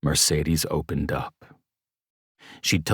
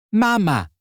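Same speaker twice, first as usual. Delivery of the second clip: put-on high toddler voice, much higher; baby voice; 5 dB louder than usual. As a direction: say it like a little toddler. Baby Willow's first word.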